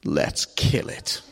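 low, slow, menacing whisper; sinister little girl. Acted. Girl tells dad to kill the goldfish, low and sinister. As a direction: say it low and slow like a tiny villain. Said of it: "looks at her dad and says".

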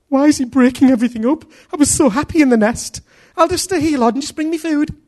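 high, fast complaining whine; whining baby bird believer. Acted. Preacher voicing a comfy believer begging God to feed him, high whiny plea. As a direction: beg like a chick that won't leave the nest.

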